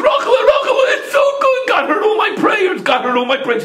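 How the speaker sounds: very high, excited cry of joy; overjoyed shouting. A narrator's reading. Husband tells Rachel his prayers were heard, overjoyed high shouting.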